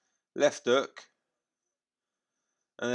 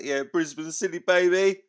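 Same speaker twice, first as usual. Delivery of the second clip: put-on cocky hometown shout-out, ending higher and louder on baby; mock Brisbane bravado; 6 semitones higher, 5 dB louder than usual. Donny's cocky Brisbane shout-out, ending loud and high. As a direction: hype up the home crowd like Donny. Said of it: "he's all like".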